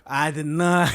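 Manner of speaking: hammy Southern statesman; Bill Clinton. Acted. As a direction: hack politician impression, a little low.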